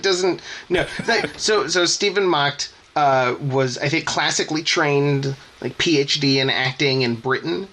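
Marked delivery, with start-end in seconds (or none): none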